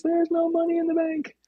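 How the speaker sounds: very high, squeaky doubting inner voice; little voice in the head. Acted. Inner voice answering a claim of rent money, in a high squeak. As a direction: pipe up as a tiny, high-pitched nagging voice. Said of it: "And the voice says".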